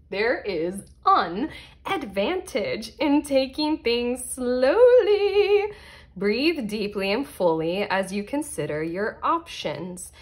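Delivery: drawn-out, exaggeratedly slow card reading; deliberately slow. Shown as a demonstration. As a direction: stretch each word to correct your rushing. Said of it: "I'm talking too fast".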